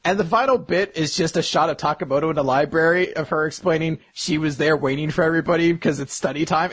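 normal talking voice

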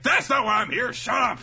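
high, loud brusque yell; hammed-up impatient bellow; Mister T-like shout. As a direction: shout them down, tough-guy style. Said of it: "He was almost Mister T for a moment there".

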